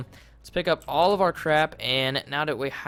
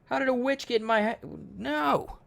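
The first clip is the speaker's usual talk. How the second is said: much higher, stunned disbelief; shocked squeal; 10 semitones higher than usual. Real, not acted. Player finding a witch inside his house, shocked high squeal. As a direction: shriek out in shock.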